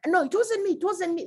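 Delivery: put-on high childish denial; a guilty kid blurting no; child denying. Kid caught eating sugar in the kitchen, high and childish denial.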